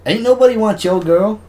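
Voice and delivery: loud, high-pitched comeback; mock shouted retort. Answering a mock accusation about his girl, loud high retort. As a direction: fire back in a play quarrel. Acted reply in girl quarrel.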